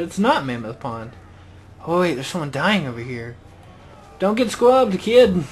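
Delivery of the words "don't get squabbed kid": loud, high rallying shout; calling out. Real, not acted. Player to someone dying nearby, calling out to help. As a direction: call out loudly to someone in trouble. Calls to a player under attack.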